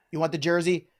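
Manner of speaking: ordinary delivery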